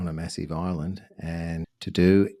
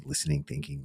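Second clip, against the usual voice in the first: ordinary delivery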